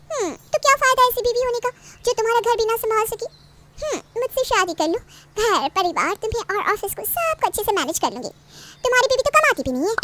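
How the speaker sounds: coaxing mistress. Acted. Mistress sweet-talking him to marry her. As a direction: coax him into leaving his wife.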